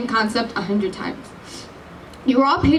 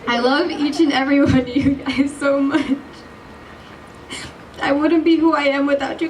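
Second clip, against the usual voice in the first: tearful, still choked. Real, not acted. After crying, resuming speech.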